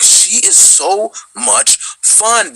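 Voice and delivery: high, slow gushing delight. Retold or imitated. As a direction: savor every word with glee. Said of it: "I was like".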